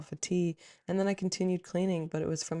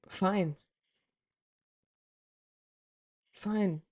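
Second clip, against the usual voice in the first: weary fine; 4 semitones higher than usual. Retold or imitated. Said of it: "started crying".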